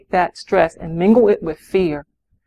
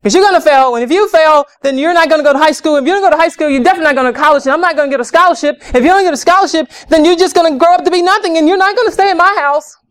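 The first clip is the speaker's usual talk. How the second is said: parent yelling; 7 semitones higher, much faster than usual. Acted. Stressed mother yelling at her child about homework, shrill panicked rant. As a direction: pile on threats like a panicked parent. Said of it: "Yell at them".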